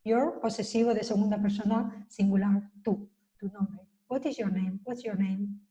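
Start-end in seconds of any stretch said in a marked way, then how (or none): none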